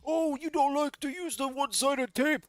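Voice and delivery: high, mocking insults; mock sneering chatter. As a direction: sneer it like a nasty fan. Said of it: "retellings are just so one-sided".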